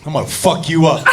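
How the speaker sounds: acted menace, pitched well down; low threat. Buddy has snapped and threatens her, low and menacing. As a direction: imitate a deadly serious threat, very low.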